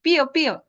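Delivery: high, light pío pío like a chick; chick chirp imitation. Shown as a demonstration. To the child, playing the chick sound for a listening game. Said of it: "hago escuchar un pollo".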